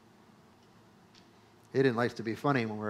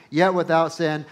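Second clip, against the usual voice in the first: ordinary delivery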